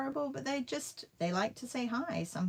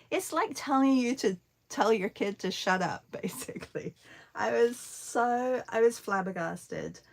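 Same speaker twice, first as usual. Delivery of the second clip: ordinary talk, nothing marked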